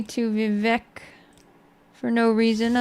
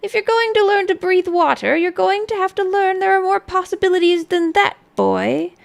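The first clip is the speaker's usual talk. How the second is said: put-on higher, louder amused old woman; old sorceress voice; 8 semitones higher, 6 dB louder than usual. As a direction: lecture him like a wily crone. Old sorceress answers the boy.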